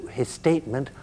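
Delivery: ordinary talk, nothing marked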